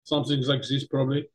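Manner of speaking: put-on low-key German accent. Co-host tests an Austrian name, in a German accent. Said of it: "Austrian or German name".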